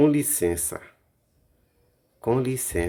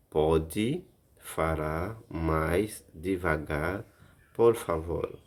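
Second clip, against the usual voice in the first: very slow model; 2 semitones lower, much slower than usual. Shown as a demonstration. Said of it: "teacher models phrase".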